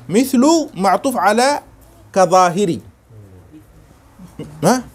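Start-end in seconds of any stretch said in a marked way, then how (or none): none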